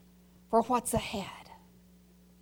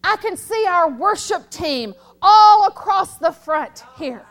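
ordinary talk, nothing marked

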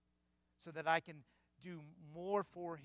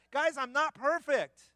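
loud, forceful, higher declaration; emphatic Paul; 11 semitones higher, 10 dB louder, slower than usual. Retold or imitated. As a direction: drive it home like an apostle insisting. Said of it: "He's emphatic".

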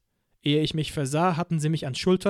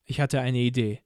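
usual speaking voice

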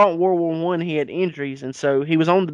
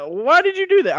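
much higher, shocked falsetto; shrill woman voice; 4 dB louder than usual. Pretend. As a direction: squeak it out, aghast. Woman asking Roosevelt, voiced.

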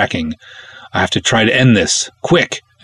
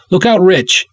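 shouting a warning; 10 semitones higher than usual. Acted. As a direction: yell it across the room to him. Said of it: "I shout".

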